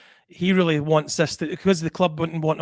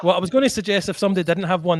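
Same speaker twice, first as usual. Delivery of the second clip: normal talking voice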